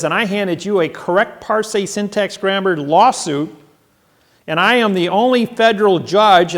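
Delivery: telling the judge he holds the court, loud and insistent. Retold or imitated. Still addressing the judge.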